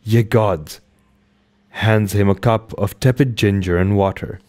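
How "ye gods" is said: plain reading voice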